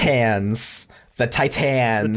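stretched "Titans", second syllable a full "tans"; exaggerated Titans. Shown as a demonstration. Friend mocking the pronunciation debate, over-pronounced Titans. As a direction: draw out "Titans" as tie-TANS, slowly. Exaggerated demo of disputed word.

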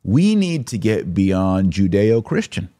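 usual speaking voice

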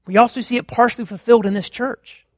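ordinary talk

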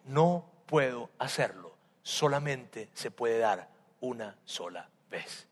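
low, slow, soft final refusal; quiet refusal. Acted. Father refuses Esau again, slow and soft. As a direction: let the old father refuse gently.

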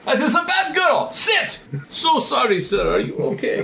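put-on high, slow Russian accent scolding a dog. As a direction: bark orders in a Russian accent. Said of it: "Good Russian accent".

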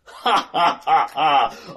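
slow gloating villain cackle; evil laugh. Acted.

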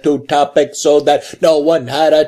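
very slow, measured recital; Picard. Retold or imitated. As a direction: draw out every word in an endless unbroken speech. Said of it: "Like, Picard's, like, trying to make a point".

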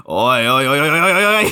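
rising repeated oi, mock anime shout; imitating Connie's oi. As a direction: call oi over and over like the Japanese Connie. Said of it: "Connie just starts going".

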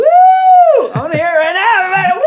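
high, loud hype-up call; excited call-out. Real, not acted. Hyping listeners to shout woo.

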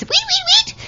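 high squeaky guinea pig squeaks. Retold or imitated. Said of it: "followed immediately by the guinea pig noises".

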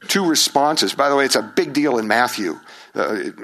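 ordinary talk, nothing marked

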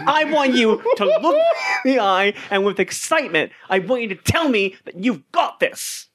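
high-pitched, insistent encouragement; rousing pep talk. Acted. Doctor pushes Larry to believe in himself, rousing. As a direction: urge him on with building enthusiasm.

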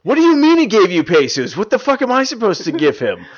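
loud, high indignant yelling; outraged shout. Acted. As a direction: yell at the customer in disbelief. Acting customer arguing over pesos.

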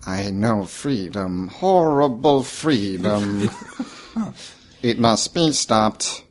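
slow, quiet, ominous creature. Acted. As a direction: speak slowly like an awakened creature.

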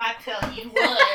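high, laughing Southern twang; Southern accent. Retold or imitated. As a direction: tease with an exaggerated Southern accent. Teasing Southern accent imitation.

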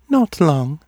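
high, detached oracle voice; distant. A narrator's reading. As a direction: pitch it high and far away, as if dreaming.

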